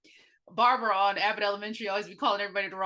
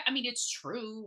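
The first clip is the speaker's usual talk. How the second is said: ordinary talk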